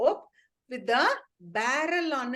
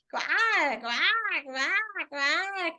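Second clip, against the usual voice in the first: higher, mournful put-on duck quacks; duck crying; 4 semitones higher, much slower than usual. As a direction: make the duckling cry in quacks. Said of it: "How will a duck cry?".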